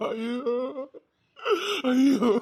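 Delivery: loud grieving cry of ஐயோ; loud despairing ஐயோ over the body; anguished wail. Acted. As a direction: wail it out in grief. Wailing over friend's body.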